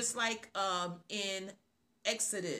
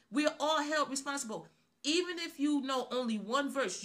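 preaching; 5 semitones higher than usual. Acted. Preacher urging believers to proclaim the verse they know. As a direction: drive it home like a fired-up preacher.

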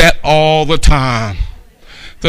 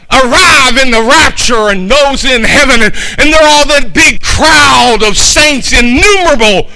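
raised, ringing preacher's voice, high and loud; full-force revival sermon; fervent preaching; 7 semitones higher, 4 dB louder than usual. Acted. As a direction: preach it out to the whole room. Preaching to the congregation.

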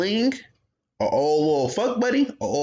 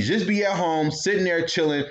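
normal talking voice

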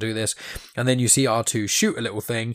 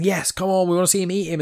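higher, cheering arena shout; mock crowd yell; 8 semitones higher, 3 dB louder, faster than usual. Arena crowd cheering the monster on, mock yell. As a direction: egg on the beast like a bloodthirsty fan. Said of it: "everyone's yelling".

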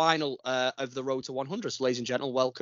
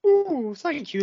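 much higher, louder mock delight; 13 semitones higher, 5 dB louder, much slower than usual. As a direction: coo it sarcastically. Mock thrill at thank yous.

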